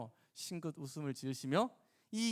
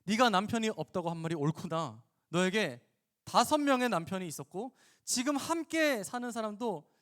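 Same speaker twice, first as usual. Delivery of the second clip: louder, emphatic pronouncement; forceful; 5 semitones higher, 5 dB louder than usual. Acted. Jesus reveals the woman's secret about husbands, forcefully. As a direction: put force behind each key word. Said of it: "힘을 주어서 말씀하시기 시작해요".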